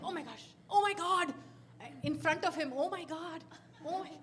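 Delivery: high, loud panicky oh my gods; mock panic. Retelling fright while pushing stuck wheelchair, high panicky cries. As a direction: babble in fake alarm. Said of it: "each time saying".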